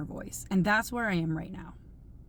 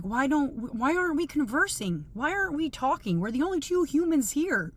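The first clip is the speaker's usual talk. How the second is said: higher-pitched mock-exasperated questioning; exasperated plea; 7 semitones higher than usual. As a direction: plead in a raised voice, imitating your own frustration. Voices own exasperated plea to silent companion.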